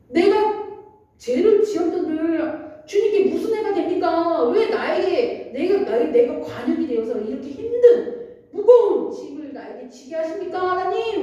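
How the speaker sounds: acted plaintive protest, pitch lifted; complaining to God. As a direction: plead upward as Job, protesting an unfair burden. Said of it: "막 이러면서 하나님께 불평을 합니다".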